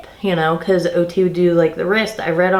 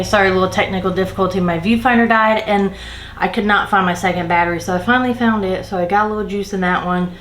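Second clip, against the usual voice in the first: ordinary talk, nothing marked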